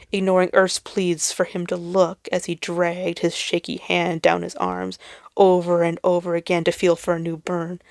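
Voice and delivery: ordinary delivery, nothing marked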